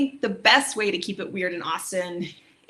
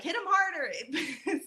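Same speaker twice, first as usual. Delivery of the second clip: soft, slow, laughing translation; 9 semitones higher, 4 dB quieter, slower than usual. Shown as a demonstration. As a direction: chuckle through the words. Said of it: "which means, like".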